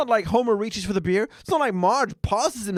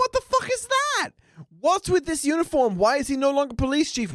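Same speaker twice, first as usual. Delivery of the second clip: incredulous outburst; 11 semitones higher than usual. Real, not acted. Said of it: "ranting about Wiggum".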